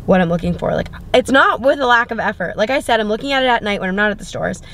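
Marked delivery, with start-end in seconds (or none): none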